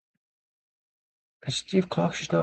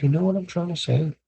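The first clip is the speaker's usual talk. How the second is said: ordinary talk, nothing marked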